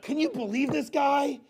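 high, slow, incredulous complaint; mock outrage. Preacher mimics a troll's victim complaining, mock outraged. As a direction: play someone appalled and stretch it out.

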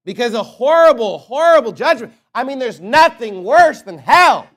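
full-force sermon climax; preaching at full force. Real, not acted. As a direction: preach hell's torment to the crowd.

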